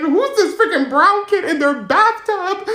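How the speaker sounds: high, slow indignant outburst; infuriated parents. Retold or imitated. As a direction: demand it like outraged parents. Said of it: "the parents are infuriated".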